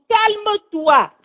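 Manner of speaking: slow, high, drawn-out calme-toi; soothing. Real, not acted. Mother soothes her crying daughter, slow and drawn out. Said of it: "Je t'ai dit, calme-toi".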